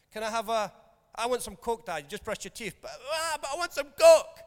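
put-on high, loud, whiny kid voice; whining child. Child whining to dad for Coke, high and loud. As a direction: wheedle like a kid after brushing teeth.

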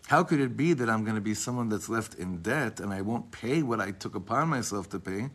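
ordinary delivery

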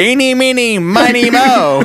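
high sing-song. Acted. Mocking rhyme quoted from show.

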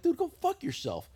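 high-pitched mock indignation; retold exasperated retort. Acted quarrel over parking.

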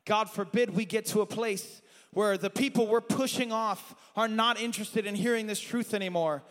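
ordinary talk

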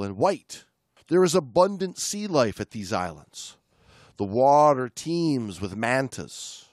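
steady read-aloud narration; book reading. Acted. Podcast host reading a diving memoir aloud, narrating. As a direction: read the passage to the listener.

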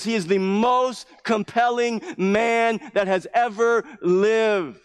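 loud, high, driving sermon delivery; full-force preaching. Acted. Pastor preaching to his congregation, fervent and loud. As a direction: preach it with rising fire.

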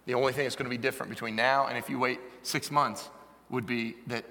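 ordinary talk, nothing marked

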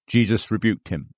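ordinary talk, nothing marked

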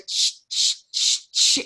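whispered shh shh of a nappy rubbing as you walk; imitated rustling nappy. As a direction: hiss out the swishing of an adult nappy. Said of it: "when you walk and it goes".

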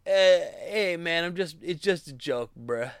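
hammed-up bro excuse; mocking dudebro. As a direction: brush it off like a smug bro. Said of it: "But seriously".

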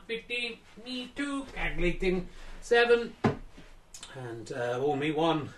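hushed snooker commentary; sports commentator. Acted. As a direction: murmur the shots like TV coverage.

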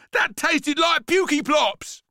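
put-on high, loud disgusted kid; screaming boy. A narrator's reading. Boy spits out grapefruit, screamed disgust. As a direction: yell it shrilly in revulsion. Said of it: "screamed Frankie".

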